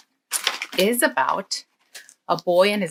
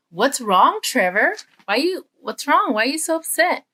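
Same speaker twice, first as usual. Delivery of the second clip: lightly voiced mom, higher and louder; concerned mom; 6 semitones higher, 3 dB louder than usual. Mom checks on her upset son, lightly voiced concern. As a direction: ask with a mother's mild, rising concern.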